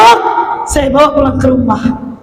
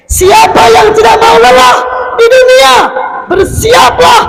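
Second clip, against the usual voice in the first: high, loud warning; fiery preaching; 9 semitones higher, 4 dB louder than usual. Real, not acted. Preacher warning the youth, high and loud. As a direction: thunder the warning at the crowd.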